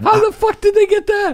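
high, loud squawk through laughter; mock outraged disbelief. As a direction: shriek it in comic shock. Mock shock at the joke.

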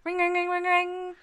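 high sing-song ring ring; phone ring imitation. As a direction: chirp it like a ringing phone. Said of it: "What does your customer service voice sound like?".

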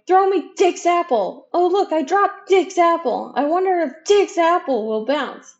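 high, loud schoolyard taunting; mock shouting. A narrator's reading. Big boys tossing Dick's apple over his head, shouting. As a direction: call it out high and loud like jeering kids.

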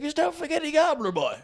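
high, quick, insistent retold voice; acted eager reintroduction. Man reminding bar owner of his nickname, acted eagerness. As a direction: pitch it way up, imitating an eager old pal.

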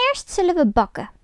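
scheming witch to Grietje. A narrator's reading. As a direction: sound eager to bake. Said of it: "zei de oude vrouw".

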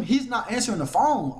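ordinary delivery

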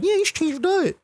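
very high, squeaky mimicry; mock her in a tiny cutesy squeak. Counter woman butting in on his diet, mocked as cutesy. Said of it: "thought she was being cute".

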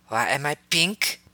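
slow indignant demand. A narrator's reading. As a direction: demand it loudly. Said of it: "Ayla demanded loudly".

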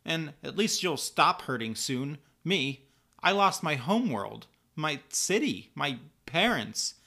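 high, loud, upset protest; tearful outburst. A narrator's reading. Kara to Linda who yelled at her, tearful hurt. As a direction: stammer it out as if starting to cry. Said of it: "Kara begins to cry".

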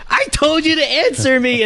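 high, imperious mock order; mock Baroness demand. Baroness scolds silent Ace, high imperious demand. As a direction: demand an answer in a high shrill-free squeal of authority.

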